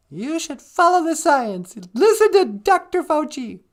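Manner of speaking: high, loud mocking voice; mock official voice. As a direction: squeak it out like a preachy official.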